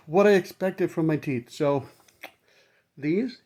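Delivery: usual speaking voice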